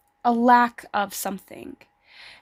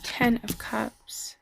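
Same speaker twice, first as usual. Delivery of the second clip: whisper; 8 dB quieter, slower than usual. Real, not acted. Whispered card reveal.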